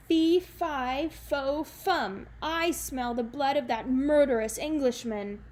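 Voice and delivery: loud booming giant chant, high and heavy; bellowing giant. Acted. The giant bellows its chant at Jack, loud and menacing. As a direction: boom it out like a vengeful giant. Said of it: "the giant bellowed at Jack".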